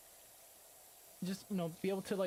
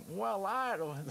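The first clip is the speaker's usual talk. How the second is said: high, loud Texan drawl; mock Texan, pitched up; Texas accent; 8 semitones higher, 5 dB louder, slower than usual. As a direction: put on a thick Texas twang. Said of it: "But put me in Texas".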